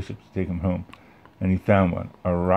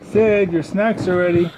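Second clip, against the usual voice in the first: much higher, louder mother's call; mom calling upstairs; 10 semitones higher, 4 dB louder than usual. Acted. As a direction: call the kid down to eat. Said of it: "Sid's mom yelling".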